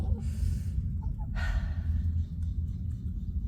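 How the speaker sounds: long airy breath in, high; deep meditative breath. Acted. Duck meditating in the barn, long slow breath. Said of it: "Duck breathed in slowly and deeply".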